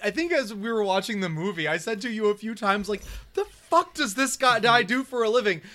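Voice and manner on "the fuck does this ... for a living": re-enacted amazement, higher and louder; retold disbelief. Viewer re-enacting a reaction to the huge fighter, amazed. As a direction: exclaim in mock bewilderment, drawing it out slightly.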